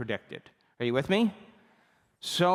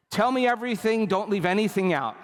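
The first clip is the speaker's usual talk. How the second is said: eager; 7 semitones higher, 4 dB louder than usual. Retold or imitated. Eager request for the story.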